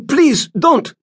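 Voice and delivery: high, loud desperate plea; pleading. A narrator's reading. Doc begging the Tsarina not to cut her son. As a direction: beg her to stop, voice up high. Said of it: "Doc pleaded".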